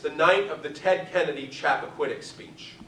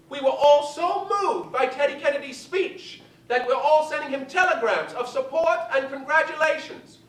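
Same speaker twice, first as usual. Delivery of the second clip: high, grand diva voice; Betty Davis; 11 semitones higher, 3 dB louder than usual. Retold or imitated. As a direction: lay it on like an aging star. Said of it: "Betty Davis".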